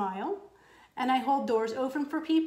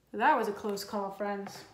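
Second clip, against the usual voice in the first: ordinary delivery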